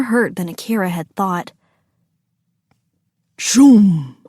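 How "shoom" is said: loud "shoom" sound effect; explosive whoosh. A narrator's reading. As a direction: voice a sudden fiery burst. Narrator voices exploding tree sound.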